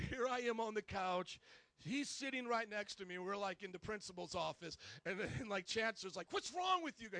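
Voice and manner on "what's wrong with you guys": high, exasperated scolding; acted-out angry boss; mad. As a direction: scold in disbelief, pitched way up. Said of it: "He was all mad at us".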